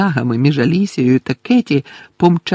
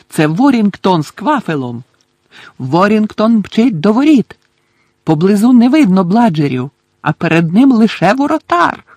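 higher-pitched live play-by-play; excited match commentator; 6 semitones higher, 4 dB louder than usual. Acted. Commentator calls Warrington's charge at the goal, excited. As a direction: call the attack, rising in excitement.

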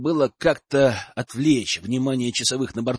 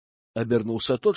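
usual speaking voice

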